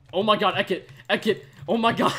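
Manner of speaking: real alarm, shouting his name; panicked shout. Real, not acted. Gamer yelling as teammate Ekit burns, panicked shout. Teammate on fire, about to die.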